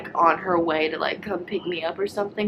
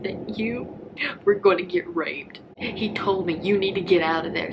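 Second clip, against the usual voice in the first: retold crying. Mother-in-law sobbing a warning to her son's wife.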